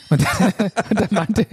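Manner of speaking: ordinary talk, nothing marked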